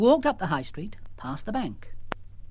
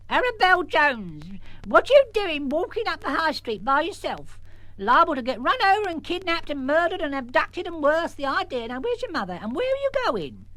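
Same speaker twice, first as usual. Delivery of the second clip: scolding great-aunt; 10 semitones higher than usual. Acted. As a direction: scold her like a flustered old aunt.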